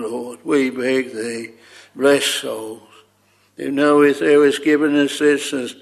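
ordinary talk, nothing marked

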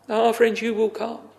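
ordinary delivery

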